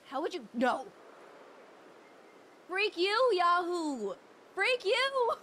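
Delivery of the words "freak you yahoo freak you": high, loud playful cursing; mock-angry yell. Streamer cursing out viewer Yahoo, mock-angry yell.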